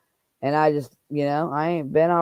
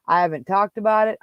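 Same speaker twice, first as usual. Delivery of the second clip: ordinary delivery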